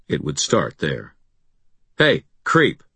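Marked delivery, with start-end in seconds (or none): 2.0-2.8 high, loud taunt; calling out. A narrator's reading. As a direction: call out to the enemy. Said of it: "I called".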